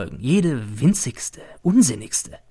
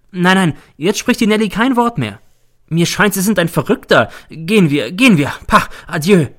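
high, louder, agitated woman; indignant dismissal; 7 semitones higher, 5 dB louder than usual. Acted. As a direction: send him packing, scornful at the end. Frightened Nelly throws the visitor out. (aufgebracht hinauswerfend)

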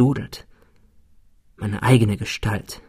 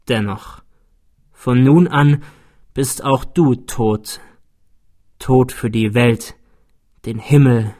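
slow, heavy dying man; dying double. Acted. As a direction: speak slowly as a dying man. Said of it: "seine Sprache war kein Flüstern mehr".

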